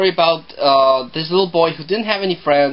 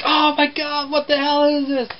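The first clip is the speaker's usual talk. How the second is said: high, shrieky mock panic from a mom; mom freaking out; 10 semitones higher than usual. Mother sees the living bear walk in, mock panicked shriek. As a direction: imitate a horrified mother spotting a monster. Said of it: "the mom freaks out like".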